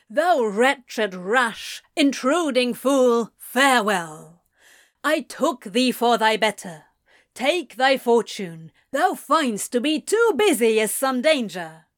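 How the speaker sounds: loud, theatrical Shakespearean recital; dramatic declaiming. A narrator's reading. Crowley mocking the departed Gabriel, dramatic declamation. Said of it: "strike a dramatic pose".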